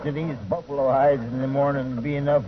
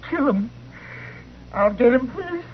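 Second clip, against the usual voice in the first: raised pitch, raw vengeful outburst; grief-stricken rage; 8 semitones higher than usual. Acted. Father vowing revenge over his dead sons, anguished rage. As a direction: lash out in grief after finding your boys.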